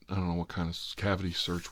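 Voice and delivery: ordinary delivery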